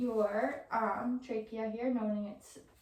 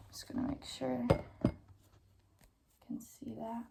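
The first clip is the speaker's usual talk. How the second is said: whispered aside; whispering; 3 dB quieter than usual. Real, not acted. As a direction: drop to a whisper under your breath. Aside while examining patient.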